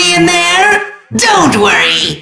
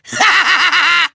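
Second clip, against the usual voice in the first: big theatrical villain laugh; evil cackle. Acted. Villain gloats.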